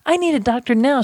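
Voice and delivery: narrator's restrained version of a patient screaming; mild mock scream. A narrator's reading. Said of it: "screamed Mister Woodruff".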